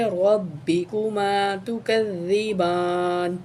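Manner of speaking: measured recitation of the phrase; careful chanted phrase; slow recitation. Shown as a demonstration. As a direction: recite it slowly with tajweed. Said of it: "روا کو موٹا کر کے پڑھیں گے".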